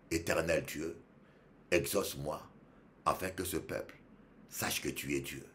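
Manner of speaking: low, soft plea; hushed, understated petition; simple unshouted prayer. Retold or imitated. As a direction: pray quietly without any shouting. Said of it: "Il n'a pas crié, hein? Il a dit simplement".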